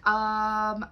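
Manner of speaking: loud, long-held "um"; mock hesitation. Coach imitating an unsure shadow worker, exaggerated hesitation. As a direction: stall on one drawn-out, level um.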